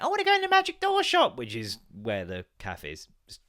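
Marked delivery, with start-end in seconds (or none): 0.0-1.4 put-on much higher little-girl voice; imitating daughter. Father imitates his daughter begging to visit the shop, high. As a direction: squeak it like an excited child. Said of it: "she'll start giving it".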